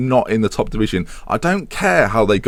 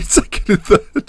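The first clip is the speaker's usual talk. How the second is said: laughing; 9 semitones higher than usual. Real, not acted. Misspoken stat, laughs.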